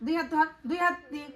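real exasperated telling-off; scolding orders. Real, not acted. Mother orders child to hold ears.

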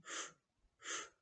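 soft voiceless f puffs, like blowing out a candle; blown f puffs. Shown as a demonstration. Teacher shows kids how to blow out a candle: soft breathy f puffs. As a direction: puff out short, breathy f sounds. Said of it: "із звуком ф".

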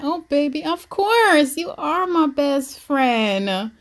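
high, drawn-out gushing reply; doting daddy. Acted. Daddy answering his daughter's request, doting. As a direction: gush it slowly, stretching the key words. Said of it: "he's like".

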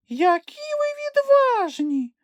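very high, slow thrilled squeal; admiring exclaim. A narrator's reading. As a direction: gush at the heroes. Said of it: "захоплено вигукнула Хробця". (захоплений вигук)

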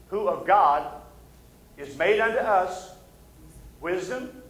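ordinary delivery

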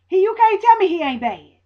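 ordinary talk, nothing marked

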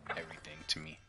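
soft sincere whisper. Real, not acted. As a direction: whisper it like a heartfelt secret. Dad's heartfelt confession to son.

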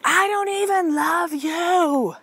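put-on high, slow childish whine; mock a sulky six-year-old; imitating a yelling kid. Son calls down the hall to mom, high whiny child voice. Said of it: "he yells down the hall at me".

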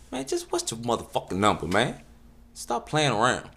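normal talking voice

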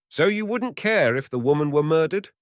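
loud, high, goading hunter; hunter's challenge. Acted. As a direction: needle him hard. Hunter confronts the forester.

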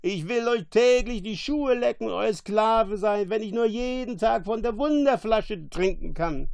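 high, loud pleading monster; screeching. A narrator's reading. Monster offering to be slave for wine, screeching. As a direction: beg shrilly for the bottle. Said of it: "kreischte Caliban".

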